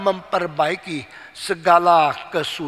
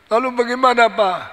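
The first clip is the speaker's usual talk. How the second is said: lightly voiced child, higher and louder; child's question; 6 semitones higher, 7 dB louder than usual. Child asks father what to do, high child voice. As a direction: ask papa in a raised, childlike pitch.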